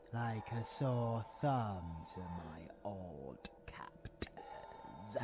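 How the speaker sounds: breathy menacing whisper; creepy whisper about the brave boy. Acted. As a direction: hiss it close and secret. Bitter whisper.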